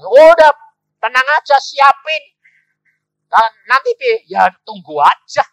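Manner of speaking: very loud, high, confident reply; booming acted "don't worry"; emphatic reassurance. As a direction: brush the worry off at full volume.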